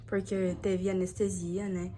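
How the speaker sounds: usual speaking voice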